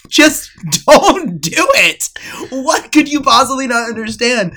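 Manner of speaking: loud, high mock outrage; exasperated class. Whole class to the classmate, exasperated shout. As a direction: yell it in comic disbelief. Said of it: "the whole group class was like".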